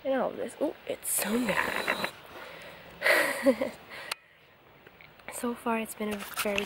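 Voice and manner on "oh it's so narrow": usual speaking voice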